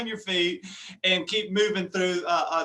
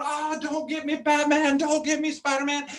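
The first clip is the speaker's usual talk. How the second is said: higher, hammed-up pleading as the chased dad; mock fear; 6 semitones higher than usual. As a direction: fake panic, playing along with the kid. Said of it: "I'm going".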